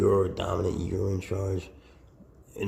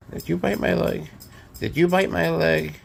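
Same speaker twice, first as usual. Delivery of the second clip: higher, louder pretend outrage; mock accusation; 10 semitones higher, 9 dB louder than usual. Owner pretends the snake bit her, mock outraged. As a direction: accuse the pet in play.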